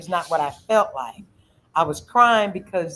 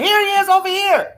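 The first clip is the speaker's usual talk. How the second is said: higher and louder, shouting across the store; calling out; 12 semitones higher, 6 dB louder than usual. Retold or imitated. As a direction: point him out to everyone. Bystander calling out the man.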